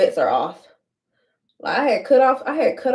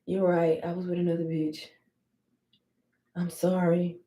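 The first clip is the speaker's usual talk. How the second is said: softer, lower sheepish admission; sheepish quiet; 3 semitones lower, 8 dB quieter than usual. Retold or imitated. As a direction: confess it meekly. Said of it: "he was like".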